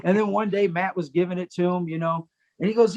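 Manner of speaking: ordinary talk, nothing marked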